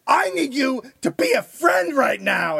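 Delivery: high, loud mock girlfriend tirade; imitated screaming. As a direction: yell it like a furious girlfriend mid-fight. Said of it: "are fucking screaming at each other".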